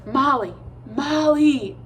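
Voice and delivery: loud stage whisper, rising on the second Molly; urgent half-whispered call into the dark. A narrator's reading. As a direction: call her name in a hushed but urgent voice. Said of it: "He decided to chance a whisper".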